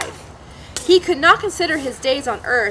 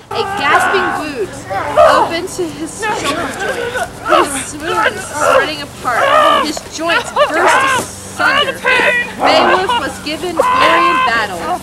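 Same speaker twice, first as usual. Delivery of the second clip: higher, louder and slower; dramatic climax reading; 7 semitones higher, 7 dB louder than usual. Acted. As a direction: build the battle to its peak. Climax of the story read.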